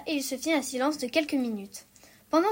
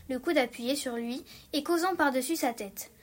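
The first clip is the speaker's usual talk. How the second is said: ordinary delivery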